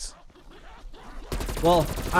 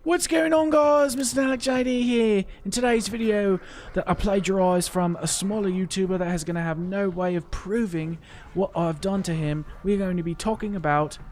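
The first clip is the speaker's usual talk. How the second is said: hyped high youtuber greeting; Dalek JD impression; 5 semitones higher than usual. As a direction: pitch way up like an excited YouTuber. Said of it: "what voice you want me to do, Dalek?".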